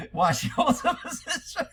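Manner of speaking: high, breaking up with laughter while reading; real helpless laughing. Real, not acted. Reading a viewer's joke comment aloud, cracking up. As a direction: try to finish the line through your laughter.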